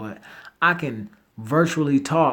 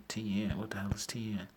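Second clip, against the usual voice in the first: puzzled whisper; 4 semitones lower, 14 dB quieter, faster than usual. Real, not acted. Puzzled reading of a typo.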